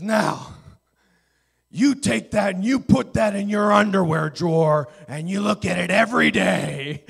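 hammed-up furious shout, high and very loud; ripping into them. Chancellor commanding student pastors, imitated raging shout. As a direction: bellow orders like an enraged old preacher. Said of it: "just rips into us".

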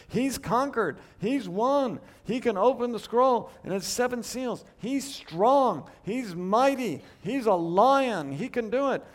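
lion-like triumphant praise; triumphant proclaiming. Real, not acted. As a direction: preach it with rising triumph.

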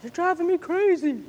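high, strained cry of a worn-out parent; mock-exasperated wail. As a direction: throw up your hands at the kids. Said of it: "the next moment you're like".

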